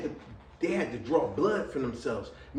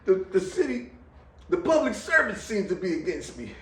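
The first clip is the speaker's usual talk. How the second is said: half-laughing lament; 7 semitones higher than usual. Retold or imitated. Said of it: "'Cause I said".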